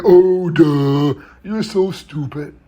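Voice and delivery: dopey, drawn-out teasing; mocking taunt. Classmates taunt a boy who stutters, slow mocking. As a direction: imitate kids jeering, dragging the words out. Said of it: "And they'll call me".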